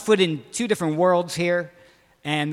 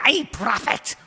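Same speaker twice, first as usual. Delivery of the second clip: higher-pitched mock Scottish brogue; attempted Scottish accent; 5 semitones higher, slower than usual. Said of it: "doing it in a Scottish accent".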